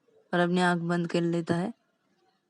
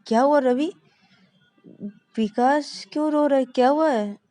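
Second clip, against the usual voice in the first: higher, anxious questions; worried sister; 6 semitones higher, 4 dB louder than usual. Acted. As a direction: ask urgently, alarmed. Shreya alarmed asks Ravi. (घबराकर ऊँचे सुर में)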